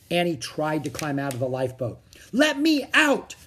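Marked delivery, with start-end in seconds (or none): 2.3-3.4 high, loud, slow plea; desperate cry. A narrator's reading. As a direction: cry out to be set free. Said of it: "she cried".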